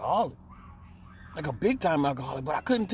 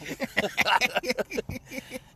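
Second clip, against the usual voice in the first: giggle; 7 semitones higher, 4 dB quieter than usual. Real, not acted. Laughs after quote.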